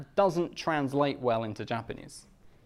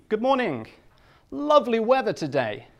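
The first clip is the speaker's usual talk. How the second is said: bright, higher, mock-cheerful small talk; ironic cheerful greeting; 7 semitones higher, 4 dB louder than usual. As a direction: cheerfully joke about the awful rain. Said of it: "and I said in Japanese".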